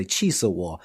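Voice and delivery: normal talking voice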